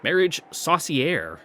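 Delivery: slow, emphatic "saucière", ending like "air"; deliberate pronunciation. Shown as a demonstration. Reader settling on how to say it, deliberate pronunciation. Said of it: "Gonna pronounce it like that".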